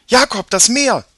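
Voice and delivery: put-on a girl's voice jumping up in alarm; high voice. A narrator's reading. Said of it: "mit hoher Stimme".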